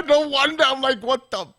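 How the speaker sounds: high, laughing through the words. Real, not acted. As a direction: talk while still laughing. Laughing at the phone mixup.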